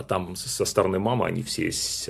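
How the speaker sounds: ordinary talk, nothing marked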